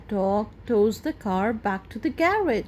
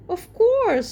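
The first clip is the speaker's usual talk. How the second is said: Grandad Dog voice; 11 semitones higher, 5 dB louder than usual. Acted. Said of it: "says Grandad Dog".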